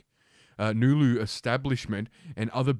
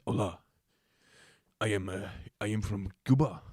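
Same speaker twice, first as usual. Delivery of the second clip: lightly hammed-up Cuban accent; a hint of a mock Cuban accent. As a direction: play a Cuban introducing himself, badly. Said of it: "I don't even know what a Cuban accent is".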